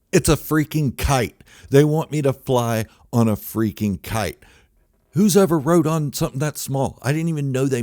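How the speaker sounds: loud and high, indignant disbelief; outraged shout. Acted. Comedian shouting about being booked on a tiny plane, outraged. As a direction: belt it out like you can't believe your eyes.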